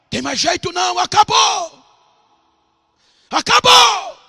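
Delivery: high, despairing cry rising on acabou; preacher acting out a father giving up, pitched very high; desperate father.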